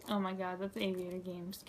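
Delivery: normal talking voice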